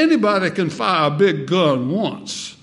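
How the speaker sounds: punchy mock South Carolina preacher; South Carolina accent. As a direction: deliver the quip in his South Carolina accent. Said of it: "had that South Carolina accent".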